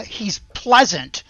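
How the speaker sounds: usual speaking voice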